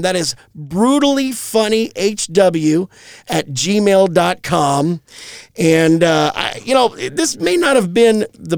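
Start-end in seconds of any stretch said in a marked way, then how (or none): 0.6-5.0 spelled out slowly. Real, not acted. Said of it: "let's give that email address out".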